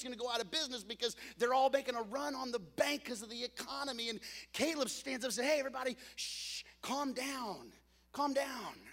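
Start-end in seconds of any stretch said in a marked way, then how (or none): 5.5-8.9 hushing calm down. Acted. Caleb hushing scared crowd.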